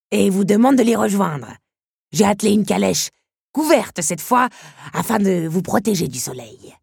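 lightly voiced coachman inviting Belle to the countess; coachman's voice. A narrator's reading. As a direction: politely call her to the carriage. Coachman Mouchard speaking to Belle.

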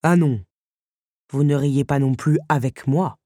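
low-voiced, lightly played stern beast; offended beast. A narrator's reading. The beast rebuking Belle for her laughter, stern and low. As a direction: answer low, with wounded dignity.

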